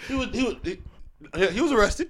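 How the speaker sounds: high, laughing; trying not to laugh. Real, not acted. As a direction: hold back a laugh, pitch up. Laughing about the arrest.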